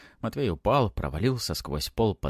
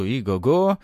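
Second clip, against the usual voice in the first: imitated horse whinny, raised and loud; loud mock neigh; 4 semitones higher, 6 dB louder, slower than usual. A narrator's reading. Boy leaps at the corridor's end, neighing loudly. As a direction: cry out like a horse. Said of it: "громко закричал".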